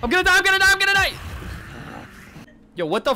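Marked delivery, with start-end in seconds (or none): 0.0-1.1 high, frantic rapid-fire cries; panicked yelling. Real, not acted. Player caught by the monster, panicked yelling.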